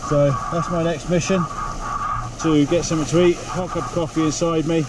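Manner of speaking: ordinary talk, nothing marked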